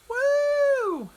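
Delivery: high, loud, drawn-out "woo"; long excited cheer; celebratory whoop. Real, not acted. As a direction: let out a triumphant whoop. Celebrating hand no longer swollen.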